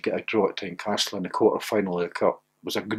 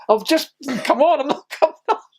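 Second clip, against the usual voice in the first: defiant retort; 4 dB louder than usual. Retold or imitated. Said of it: "I said".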